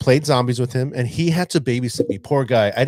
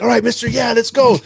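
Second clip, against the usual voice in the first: put-on higher, louder eager kid; excited kid; 9 semitones higher, 5 dB louder than usual. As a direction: pump it up like a thrilled student. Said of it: "he got so excited in the chat".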